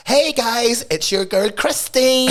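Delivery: high, drawn-out mock influencer greeting; chirpy social media influencer. Host mocking an influencer greeting followers on a story, put-on influencer voice. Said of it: "doing, like, a story or something".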